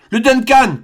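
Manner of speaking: high astonished cry. A narrator's reading. Glenarvan recognizing his own ship, high astonished cry. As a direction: shout the ship's name in relief. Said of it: "s'écria Glenarvan".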